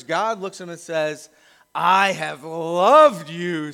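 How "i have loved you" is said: a patient, loving promise, very slow; slow tender declaration. Acted. God telling his weary people, slow and tender. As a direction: draw out each word slowly, full of love.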